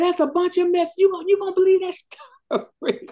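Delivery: high, mocking voice of the other lawyer; the other lawyer scoffing. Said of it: "He said".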